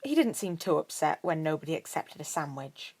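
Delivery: usual speaking voice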